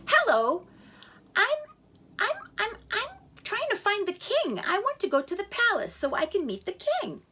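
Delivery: high, eager half-chick stammering; a tiny bird nervously asking to see the king; little chick voice. Acted. Tiny chick greets the chef.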